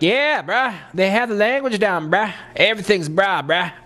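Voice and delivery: high, enthusiastic bro voice; high hammed-up bro caricature; Gary. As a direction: agree like an exaggerated Texan bro. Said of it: "He just thought, like".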